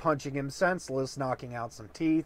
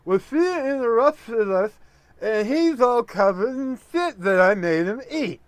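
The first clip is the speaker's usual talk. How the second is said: toothless speech; 7 semitones higher, 6 dB louder than usual. Acted. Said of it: "does his best to try and speak".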